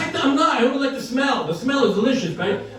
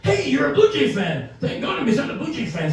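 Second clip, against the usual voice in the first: put-on chatty old fan; 7 semitones higher than usual. Old man greets a Blue Jay fan on the plane, delighted. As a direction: hail a fellow fan in a high, stretched-out voice. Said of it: "Some old guy comes".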